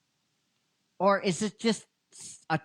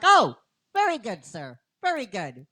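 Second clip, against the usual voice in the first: fawning shopkeeper; 4 semitones higher, 5 dB louder than usual. Retold or imitated. Retelling a shopkeeper's fawning reply.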